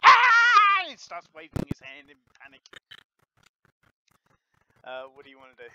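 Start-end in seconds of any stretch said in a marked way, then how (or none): 0.0-0.8 high loud yelp of pain. Acted. Beast tamer bitten by the puppy, a high loud yelp. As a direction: yelp as the dog bites down.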